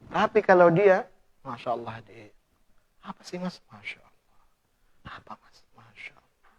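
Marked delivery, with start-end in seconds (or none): 1.4-6.5 hushed breathy "Masya Allah" in wonder; whispered awe. Retold or imitated. Husband gazing at his wife, whispering in awe. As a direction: murmur it softly, lost in admiration.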